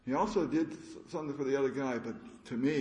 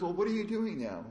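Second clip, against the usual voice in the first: higher, curious teacher voice; the teacher asks, voiced higher; teacher's question; 9 semitones higher than usual. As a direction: ask it up high as the old teacher. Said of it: "He goes".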